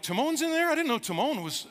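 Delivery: high-pitched mock surprise; a listener's hammed-up double take; surprised. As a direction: act startled to find Timon on the list.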